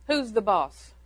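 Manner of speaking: usual speaking voice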